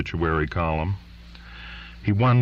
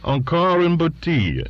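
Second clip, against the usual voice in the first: French order; 11 semitones higher, 3 dB louder than usual. A narrator's reading.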